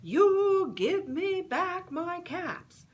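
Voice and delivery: high loud slow scolding shout; scolding monkeys. Acted. Peddler scolding the monkeys for his caps, loud and high. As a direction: wag a finger and scold.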